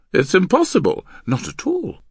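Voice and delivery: high, loud contradiction; emphatic. Acted. Husband answering his wife's disbelief, emphatic. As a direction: rebut with excited force.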